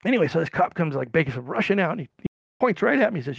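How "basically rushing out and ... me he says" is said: ordinary talk, nothing marked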